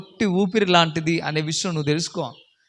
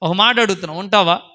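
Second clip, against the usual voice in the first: ordinary delivery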